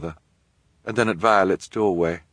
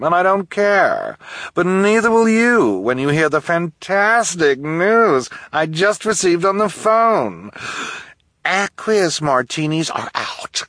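high, slow, loud trend-obsessed lady; gushing socialite; 12 semitones higher, 5 dB louder, slower than usual. Acted. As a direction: gush over the fantastic news. Esme gushing about trends.

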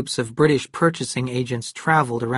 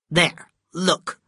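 a pained cry, pitched up and louder; cried out in pain; 5 semitones higher, 4 dB louder than usual. A narrator's reading. Kate shows Cal her crippled hand, crying out in pain. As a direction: show the crooked fingers with a cry of pain. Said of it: "she cried out in pain".

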